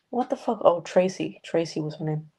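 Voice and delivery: ordinary delivery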